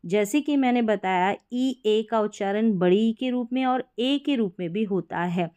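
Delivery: ordinary delivery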